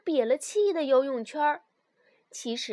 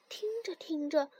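ordinary delivery

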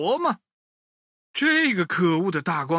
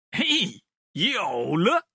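sudden idea; 2 semitones higher, much slower than usual. Acted. Woodcutter struck by a sneaky plan, excited shout. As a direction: snap up with the idea.

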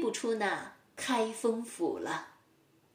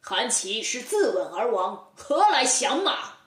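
scornful retort, rising louder at the end; 3 dB louder than usual. Acted. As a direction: sneer it back. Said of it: "却听包公冷笑道". (包公冷笑反驳陈世美)

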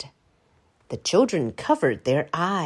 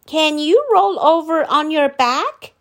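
put-on higher, louder child voice; curious children; 8 semitones higher, 6 dB louder than usual. A narrator's reading. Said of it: "asked the children".

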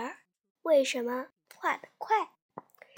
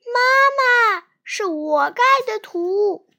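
high, loud cry of a puppy; calling out loudly; 7 semitones higher, 9 dB louder, slower than usual. Acted. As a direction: shout it out to mom. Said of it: "小狗花花大声地叫着".

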